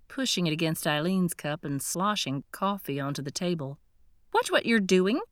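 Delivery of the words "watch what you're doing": sharp, louder snap at the clumsy waiter; snapped. Acted. Woman snaps at the waiter who spilled coffee, sharp and louder. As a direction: bite the words off, irritated. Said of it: "Eileen snapped".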